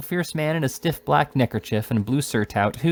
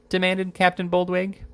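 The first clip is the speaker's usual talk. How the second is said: usual speaking voice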